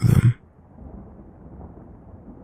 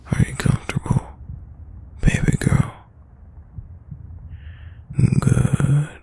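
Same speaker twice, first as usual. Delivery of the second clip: whispering; 3 semitones higher, 4 dB quieter than usual. Acted. Cuddling partner close.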